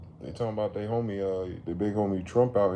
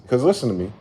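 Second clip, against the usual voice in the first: ordinary delivery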